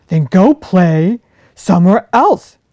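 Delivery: loud, gruff big-bear voice; a big bear sending the fox away, loud and grumpy. Acted. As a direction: shoo her off like a cross old bear. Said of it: "said Jefferson Bear".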